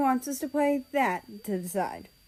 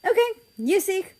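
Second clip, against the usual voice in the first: smiling; 10 semitones higher, 4 dB louder than usual. Acted. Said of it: "with a smile on his face".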